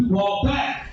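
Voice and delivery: normal talking voice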